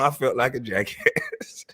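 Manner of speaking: usual speaking voice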